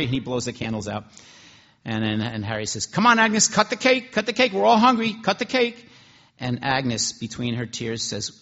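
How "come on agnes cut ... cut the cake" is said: much higher and louder, rowdy call; rally her to cut the cake; loud urging. Acted. Cook calling on Agnes to cut the cake, loud.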